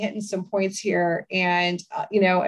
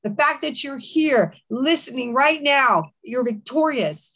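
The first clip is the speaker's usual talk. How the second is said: fervent declaring prayer; 3 semitones higher than usual. Acted. Host praying over a suicidal listener, fervent declaration. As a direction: speak life over the listener.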